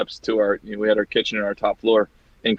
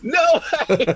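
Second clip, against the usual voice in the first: much higher, breaking into laughter; laughing quote. Retold or imitated. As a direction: squeal it out while cracking up. Retold prank, laughing hard.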